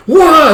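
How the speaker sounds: short high, loud mock scream; retold scream. Boy waking from a bad dream, mock scream. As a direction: yelp as if jolted from a nightmare. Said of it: "wakes up with a scream".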